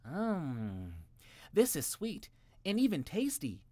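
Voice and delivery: put-on high, pleased boy voice; surprised little boy. A narrator's reading. Jimmy tastes the carrot, pleasantly surprised. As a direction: discover the taste.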